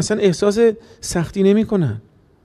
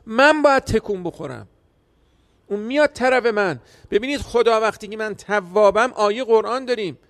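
ordinary delivery

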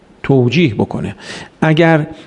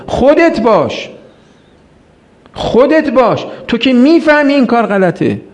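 earnest plea; 8 semitones higher than usual. Acted. Imam's plea voiced by preacher.

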